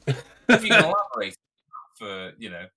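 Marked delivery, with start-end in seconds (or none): 0.1-1.3 much higher and louder put-on voice; mock interviewer. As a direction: play a pompous interviewer. Mock interviewer asking to elaborate.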